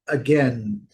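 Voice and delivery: "again" with a short uh for the first A; teacher's careful "again" with reduced opening A; schwa first vowel. Shown as a demonstration. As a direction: say "again" as uh-GEN, second syllable stressed. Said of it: "o A com som de schwa também".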